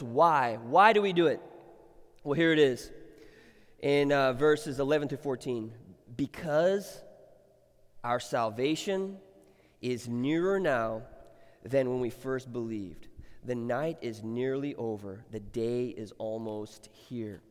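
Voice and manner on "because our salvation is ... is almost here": scripture reading. Acted. Reads scripture softly.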